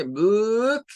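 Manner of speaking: book drawn out as "booook", rising; wrong long vowel. Shown as a demonstration. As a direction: stretch the oo in book too far. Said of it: "está haciendo el otro sonido".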